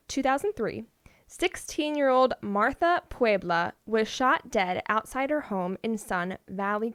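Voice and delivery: ordinary delivery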